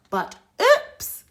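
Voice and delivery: startled little oops; surprised oops. Acted. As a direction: squeak it out caught in the act. Baby wakes up.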